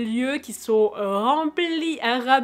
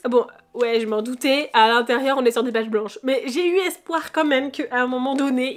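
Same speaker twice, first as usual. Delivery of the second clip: ordinary delivery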